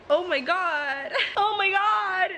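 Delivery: slow, rising tearful wail; real sobbing. Real, not acted. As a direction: cry it out, half laughing, drawn out. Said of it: "I'm sobbing".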